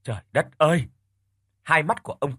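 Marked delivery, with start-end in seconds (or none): 0.0-0.9 shocked. A narrator's reading. Shocked exclamation at news.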